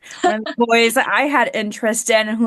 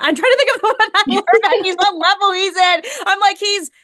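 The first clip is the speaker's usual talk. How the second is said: laughing; 10 semitones higher, 4 dB louder than usual. Real, not acted. Laughing while trying to recall.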